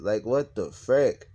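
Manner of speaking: ordinary talk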